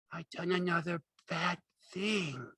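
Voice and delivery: high, slow, voiced moan of dread; frightened. A narrator's reading. Lenny realises what he has done, frightened. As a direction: draw it out fearfully, pitched high. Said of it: "whispered in fright".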